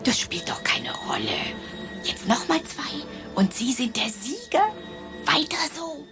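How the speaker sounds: hoch, leise, fast geflüstert; leise, körperlose Stimme von weit weg; ferne Stimme. Acted. Frau Waldorf antwortet von fern. (leise, entrückte Stimme aus der Ferne)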